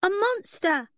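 put-on high, loud child's shriek; a little boy shrieking at a monster; frightened little boy. A narrator's reading. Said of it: "a little boy cried".

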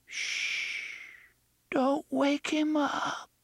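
put-on high, slow mournful child whisper; a frightened little child, mourning; eerie ghost child. A narrator's reading. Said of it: "the child's voice mourned".

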